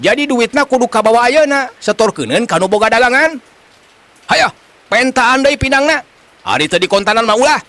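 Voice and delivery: high-pitched, indignant telling-off; scolding. Acted. Vendor demanding the money from the man, scolding. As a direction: keep the pitch up and demand it back. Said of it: "manehna ngomong nyarekan eta jelema".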